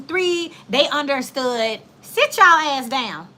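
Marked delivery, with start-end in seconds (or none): 2.0-3.3 loud, drawn-out scolding command; cussing out kids. Retold or imitated. Substitute scolding an unruly class, loud cussing out. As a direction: order them down, louder and slower. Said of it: "I cussed some kids out".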